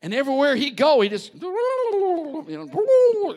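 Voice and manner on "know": sing-song high drawn-out voice; mock band sound. Preacher hamming up a body full of music, high and slow.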